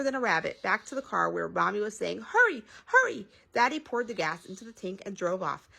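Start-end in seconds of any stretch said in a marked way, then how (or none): 2.2-3.3 high, urgent mother's plea; urgent hurry. A narrator's reading. Mother urging daddy, high and urgent. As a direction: rush him, a notch higher.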